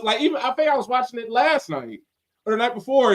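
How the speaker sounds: usual speaking voice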